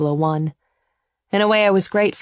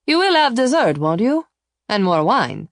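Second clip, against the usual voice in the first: much higher, louder coaxing host; insistent host; 7 semitones higher, 4 dB louder than usual. A narrator's reading. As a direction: press dessert on her warmly. Arnaldo pressing dessert.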